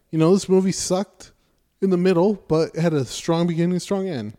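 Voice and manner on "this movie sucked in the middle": ordinary talk, nothing marked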